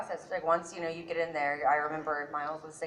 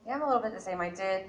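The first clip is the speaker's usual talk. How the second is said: usual speaking voice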